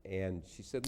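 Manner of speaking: ordinary talk, nothing marked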